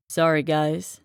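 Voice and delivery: a smug athlete's smooth apology; smooth, condescending. A narrator's reading. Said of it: "his deep voice smooth and condescending".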